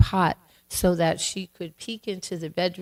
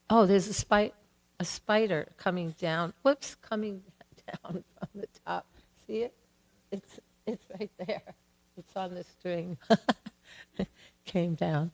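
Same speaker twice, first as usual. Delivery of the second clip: softer, half-laughing aside; amused aside; 6 dB quieter than usual. Real, not acted. Teller breaks off to point out a spider, softly amused. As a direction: drop the voice and chuckle at the spider.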